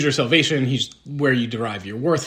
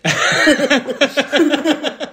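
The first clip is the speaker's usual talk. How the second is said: laughing, high and louder; 8 semitones higher, 7 dB louder than usual. Real, not acted. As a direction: laugh through the words.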